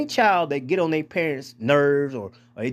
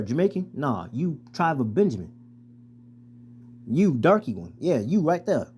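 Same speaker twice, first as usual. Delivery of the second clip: normal talking voice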